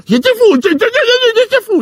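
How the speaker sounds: loud, high, laughing 'j'étais fou'; crazed laugh. Acted. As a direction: cackle it out like a madman. Manic confession of madness.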